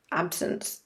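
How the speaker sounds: whispered answer, under the breath. Acted. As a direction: drop to a whisper for one word. Answering her own rhetorical question.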